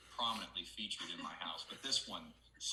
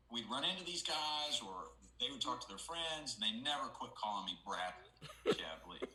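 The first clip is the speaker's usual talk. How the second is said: ordinary talk, nothing marked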